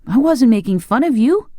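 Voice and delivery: put-on high-pitched boyish protest. A narrator's reading. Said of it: "protested Tip".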